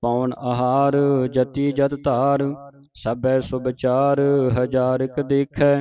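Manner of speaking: measured chant-like verse reading; sustained teaching recitation; scripture recitation. Shown as a demonstration. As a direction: recite in phrases, holding the long vowels.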